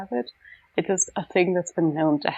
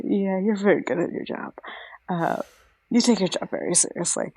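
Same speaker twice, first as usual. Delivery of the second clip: whispering. Real, not acted. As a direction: whisper it under your breath. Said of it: "So why are we whispering right now?".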